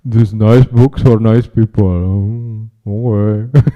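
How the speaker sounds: loud, slow, listless pitch; bored distributor. Retold or imitated. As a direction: drag out the words without interest. Mocks a bored book distributor.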